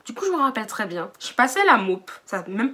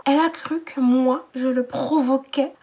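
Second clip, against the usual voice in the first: ordinary delivery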